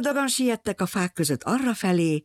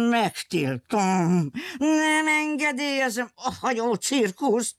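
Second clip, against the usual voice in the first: very slow, higher, stern forbidding; angry villain; 7 semitones higher, slower than usual. Acted. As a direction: lay down the law, word by word.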